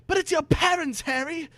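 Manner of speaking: high bewildered plea. Acted. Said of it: "Ron said".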